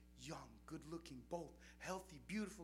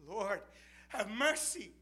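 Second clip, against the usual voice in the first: loud, high rising cry; desperate plea; 13 semitones higher, 19 dB louder, slower than usual. Retold or imitated. Friend pleading over dying man, loud desperate cry. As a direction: cry out for mercy.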